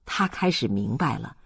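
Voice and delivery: ordinary delivery, nothing marked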